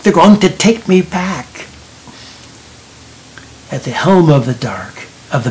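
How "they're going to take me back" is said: loud, high cry for help. A narrator's reading. Lucy calls to Johnny from the boat, crying out. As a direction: call out urgently, raised and higher. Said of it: "Lucy was crying to him".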